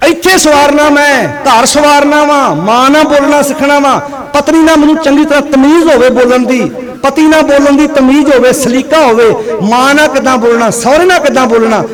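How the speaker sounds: high, loud, shouted exhortation; ringing sermon shout; full-force preaching. Real, not acted. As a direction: drive each item home at full voice.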